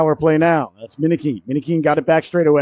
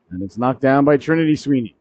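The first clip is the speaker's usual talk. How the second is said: usual speaking voice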